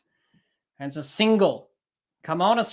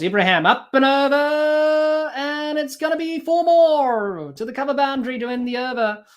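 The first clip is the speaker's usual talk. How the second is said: excited call; 6 semitones higher, 4 dB louder than usual. Real, not acted. Calling a boundary.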